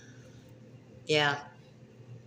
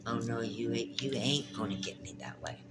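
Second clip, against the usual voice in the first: hushed defiance; 3 semitones lower, 6 dB quieter than usual. Pretend. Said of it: "You say".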